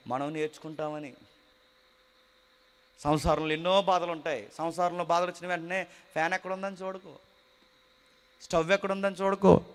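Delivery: ordinary talk, nothing marked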